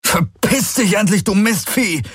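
high, strained shout over noise; angry yelling. A narrator's reading. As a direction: yell at the monster over the din. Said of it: "schrie Garland gegen den Lärm". (gegen den Lärm angeschrien)

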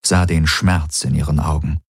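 plain reading voice

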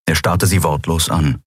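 plain reading voice

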